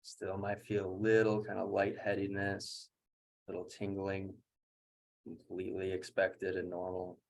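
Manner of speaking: quiet, level coaching through recovery; guided breathwork. Real, not acted. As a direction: talk them gently down after the breathing. Guides recovery after second round.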